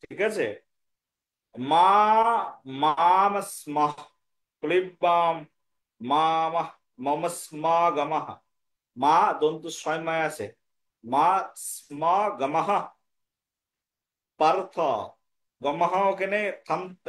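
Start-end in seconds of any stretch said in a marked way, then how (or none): 1.6-8.3 very slow, syllables held long; original pronunciation. Shown as a demonstration. Teacher showing students the original verse, slow drawn-out pronunciation. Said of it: "মূল উচ্চারণটা আপনারা". 11.0-14.8 slow, separated Sanskrit words; original pronunciation. Shown as a demonstration. Teacher showing students the verse, slow pronunciation with pauses. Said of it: "গমহ পার্থে থামতে হবে".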